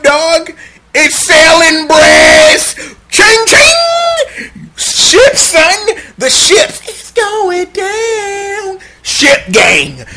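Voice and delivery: loud, high hype about the ship; crying-laughing fan hype; hyped shouting. Real, not acted. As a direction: yell it like a fan losing it. Hyping the ship loudly.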